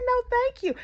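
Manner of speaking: very high mocking polite decline; shrill mock refusal. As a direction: squeak it out in disbelief. Shrill incredulous retelling.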